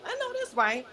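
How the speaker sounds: light high-pitched echo of approval; playful repeat. Real, not acted. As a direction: echo it up high, half to yourself.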